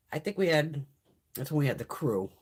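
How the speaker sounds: usual speaking voice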